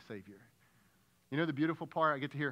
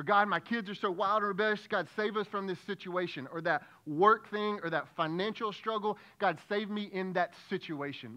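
higher, louder whiny plea; mock complaining prayer; 6 semitones higher, 5 dB louder than usual. Preacher imitating a self-centred prayer about marriage, whiny and loud. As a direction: whine at God about your marriage.

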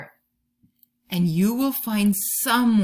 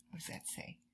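whisper; 22 dB quieter, faster than usual. Real, not acted. Teacher quietly asks about the poem.

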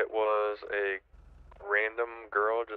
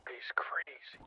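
whispered aside; 4 semitones higher, 9 dB quieter, slower than usual. Real, not acted. Aside about another player.

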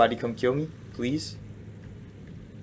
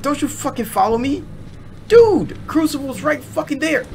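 scared and angry. Real, not acted. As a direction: shriek it while running away. Fleeing enemy in panic.

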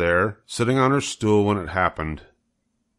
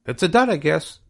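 much higher goose voice, fussing its thanks; proud goose soaking up congratulations; fussy goose; 9 semitones higher than usual. Acted. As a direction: bob and bow through every thank you. Goose answers.

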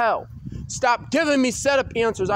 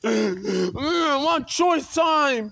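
slow, sobbing plea; crying plea; 3 semitones higher, much slower than usual. Real, not acted. Begging for choice time instead of work, crying. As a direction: plead through tears.

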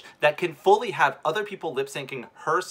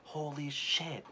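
stunned hush; 12 dB quieter, slower than usual. Real, not acted. Stunned by the outfit.

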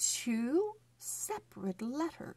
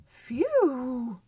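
loud, breathy relieved phew; 4 semitones higher, 7 dB louder than usual. Acted. Elephant finishing his drink, loud relieved phew. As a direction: blow out the phew like a tired elephant. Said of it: "and then he says".

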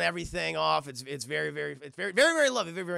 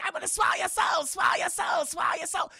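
high shouted demon taunt; 13 semitones higher, 4 dB louder than usual. Acted. Demon in Itadori's cheek taunts him in a high shouted chant. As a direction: chant it like a gleeful soul-eating demon. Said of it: "Mark Hamill Demon pops up out of his cheek".

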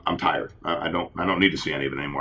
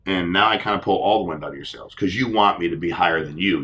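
normal talking voice

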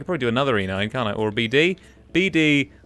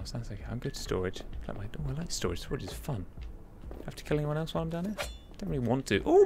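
normal talking voice

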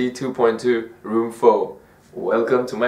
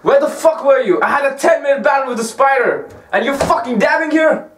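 angry yelling. Real, not acted. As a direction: chew out a useless friend. Scolding friend after spider fight.

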